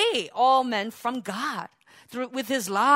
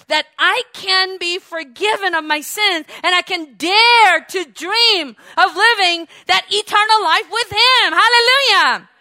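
preacher's cry swelling to a loud hallelujah; fervent full-force preaching; 5 semitones higher, 8 dB louder than usual. Real, not acted. Preacher confessing forgiveness through Yeshua, fervent proclamation. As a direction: build to a triumphant peak.

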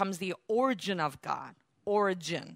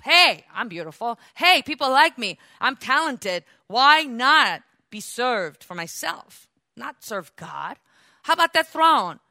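louder, higher, a smug preening devil; lightly voiced proud devil; boastful Satan; 4 semitones higher, 7 dB louder than usual. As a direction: play the vain rebel admiring himself. Voicing Satan's prideful thoughts.

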